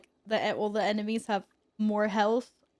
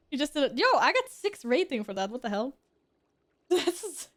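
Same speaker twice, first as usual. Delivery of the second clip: ordinary delivery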